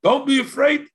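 high, loud demand; demanding call. Retold or imitated. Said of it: "they demanded".

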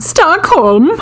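Swedish lilt on Stockholm; Swedish accent. Pretend. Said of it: "That's your, uh, Swedish accent".

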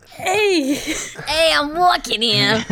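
loud, high New York street voice; laughing through a brash New Yorker bit; a hammed-up New Yorker yelling at a cab; New York tough guy. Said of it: "He'll be like".

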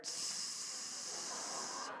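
long, soft deflating hiss; leaking-air hiss. Retold or imitated. Camper recalling the instant air mattress deflating, long soft hiss. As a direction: imitate air slowly escaping from a mattress.

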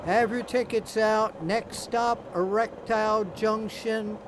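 train conductor calling. Acted. Train conductor skit on podcast.

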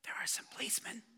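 put-on quick, hushed whisper of a small boy; whispering child. Jimmy whispering to the pastor about the police in the house. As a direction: rush it out in a secret whisper. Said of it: "a voice answered with a whisper".

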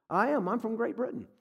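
high-pitched imitation British accent. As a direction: put on a polite Brit's accent. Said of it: "And he had an accent".

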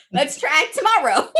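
high, breezy, laughing; laughing mock cheerful. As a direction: brush it off with a laugh. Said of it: "I'm like".

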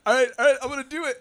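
nervous resolve. Retold or imitated. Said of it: "he's like".

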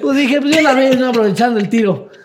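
high, loud laughing through the words. Real, not acted. Telling the host his own bathroom joke, laughing hard. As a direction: laugh out the punchline.

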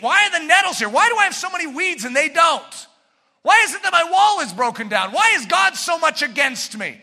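loud, high complaining sluggard; whining sluggard. Acted. Preacher voicing the sluggard's loud complaints. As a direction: shout out the sluggard's gripes.

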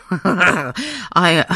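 high, loud giggles cutting the word off; giggling fit. Real, not acted. Podcast host gets the giggles, laughing. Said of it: "a full-on giggling fit".

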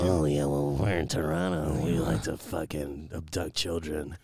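a lightly voiced Alex Jones rant; Alex Jones impression. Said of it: "that was a really good Alex Jones".

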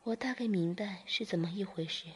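plain reading voice

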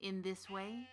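ordinary delivery, nothing marked

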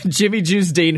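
usual speaking voice

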